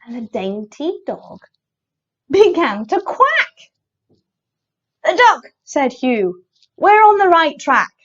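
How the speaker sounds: animated picture-book narration with big pitch swings; story read to children. A narrator's reading. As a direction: read it playfully for little listeners.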